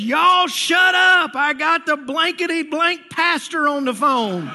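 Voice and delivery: high, loud yell at the family, slow at first; mock yell. Man yelling at loud family.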